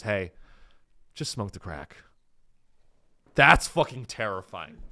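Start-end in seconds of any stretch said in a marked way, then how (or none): 0.0-2.0 lightly voiced soft-spoken kidnapper, low and quiet; a hint of understated menace, softly; mock kidnapper. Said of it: "have a kidnapper that goes".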